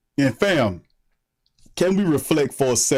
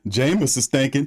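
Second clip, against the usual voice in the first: ordinary talk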